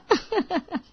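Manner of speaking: high giggle after a quip; light, high-pitched tittering; teasing laugh. Acted. Wife laughs after her businessman's-wife quip, teasing.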